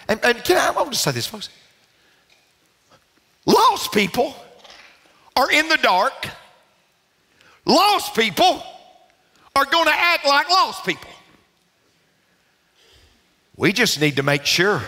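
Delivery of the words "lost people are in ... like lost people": high-pitched, measured preacher phrases; emphatic preaching. Real, not acted. Preacher warning folks about lost people, emphatic. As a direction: drive each phrase home, pausing between.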